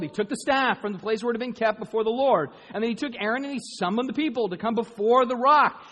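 loud, high, driving sermon delivery; full-force preaching. Acted. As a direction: preach it out to the whole hall.

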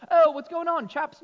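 high-pitched, kid's put-on British greeting; mock British accent. Kids after Doctor Who greet dad, high mock British accent. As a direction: burst in with a playful imitation British accent. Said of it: "we're not British".

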